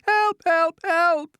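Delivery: very high, loud cries; calling for help. A narrator's reading. Stuck grandmother calling to her family at night, calling for help. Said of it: "hear her calling to us".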